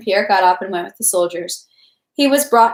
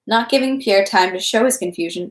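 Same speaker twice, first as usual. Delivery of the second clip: ordinary delivery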